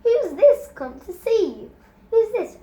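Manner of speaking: put-on adult cooing at a baby; baby talk. A narrator's reading. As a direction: coo it to the toddler. Talking to baby.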